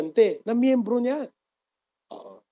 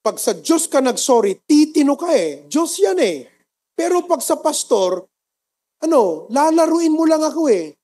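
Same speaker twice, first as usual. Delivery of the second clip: high, loud, forceful rebuke; a pastor scolding his flock, loud and high; preaching at full force; 8 semitones higher, 7 dB louder than usual. Acted. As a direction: drive the point home from the pulpit.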